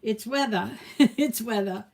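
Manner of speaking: New England "weathah", R dropped, with a little laugh; New England accent. Shown as a demonstration. Host summing up local storm slang, New England accent. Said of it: "That's how they say it".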